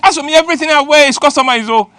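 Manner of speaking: high, rushed bragging; fast boast. Retold or imitated. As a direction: rattle off the boast. Big man bragging.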